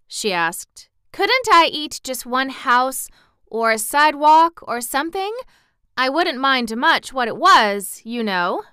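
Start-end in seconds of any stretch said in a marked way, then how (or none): 1.1-8.6 put-on higher, louder child's voice; earnest little girl, pleading. Lost girl explaining herself to townspeople, earnest and high.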